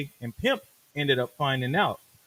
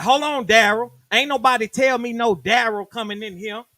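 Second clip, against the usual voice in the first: louder, higher mock challenge; suspicious rapper; 6 semitones higher, 5 dB louder than usual. Rapper questioning the new engineer: a louder challenge. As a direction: confront the stranger.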